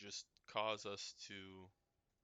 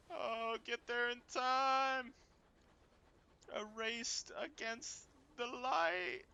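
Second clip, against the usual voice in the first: dramatic urging; 8 dB louder, much slower than usual. Pretend. Urging the ship to make it.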